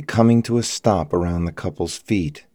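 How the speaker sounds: usual speaking voice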